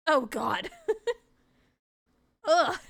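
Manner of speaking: disgusted groan. Real, not acted. Player reacts to foul stench.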